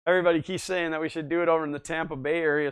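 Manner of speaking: ordinary talk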